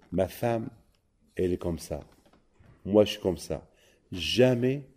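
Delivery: ordinary talk, nothing marked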